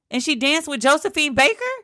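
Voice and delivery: growing mock astonishment; playing her mother, now truly amazed; amazed mom. Mom's disbelief keeps rising.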